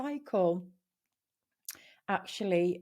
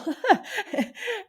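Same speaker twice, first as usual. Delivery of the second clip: real laugh; 12 semitones higher, 4 dB louder than usual. Real, not acted. Laughing at own pencil remark.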